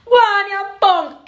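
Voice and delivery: high, slow, taunting God; mock God voice. God taunting Job in a high, slow mock voice. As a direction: sneer it down at Job from above.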